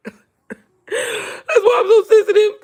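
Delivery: high, slow, laughing whimper; mock sensitive laugh-cry. Jokes she is sensitive, laughing mock cry. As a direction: ham up being sensitive with a laughing sob.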